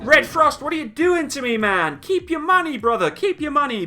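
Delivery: high, loud overwhelmed thanks; excited. Real, not acted. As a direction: blurt it out, floored by the gift. Surprised by another big donation.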